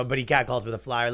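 normal talking voice

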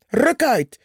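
mock shout. A narrator's reading. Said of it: "schreeuwde Purperhart".

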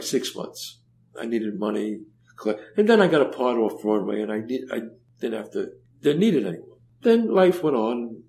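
usual speaking voice

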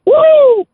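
a sudden high whoop; high happy holler; excited whoop. Real, not acted. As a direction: whoop it up like a fired-up fan. Caller hyped about fan bets.

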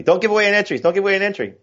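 mock yell in a high-pitched voice; imitated yelling. Co-host yelling at him over league entries, mock high-pitched yell. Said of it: "first he was yelling at me".

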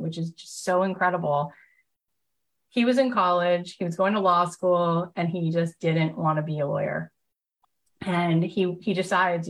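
ordinary delivery

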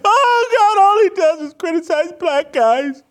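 very high, wailing crybaby voice; hammed-up tearful whine, loud and squealing; mock crying and whining. Host mocking outraged social media critics, high fake crying. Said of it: "they're gonna be crying and whining".

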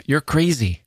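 high, sharp shout of disbelief; shrill retort. A narrator's reading. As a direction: snap it back at him, pitched high. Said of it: "Connie said".

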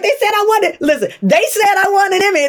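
high, breathless excitement; excited. Retold or imitated. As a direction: gush it out, still stunned. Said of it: "I'm like".

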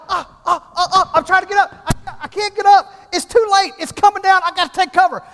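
high-pitched mock panic; freaking out. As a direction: panic as you scramble to get away. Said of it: "I start freaking out again".

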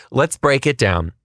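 ordinary delivery

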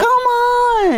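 very high-pitched, drawn-out cheer; elated exclamation. Real, not acted. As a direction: let it soar way up, thrilled by the compliment. Delighted at getting his vote.

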